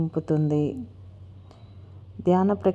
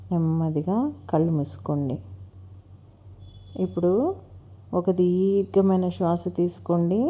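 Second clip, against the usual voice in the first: guided meditation. Acted. Instructor leading listeners to relax each body part, unhurried guided meditation. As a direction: guide them gently through breath and body, stretching key words.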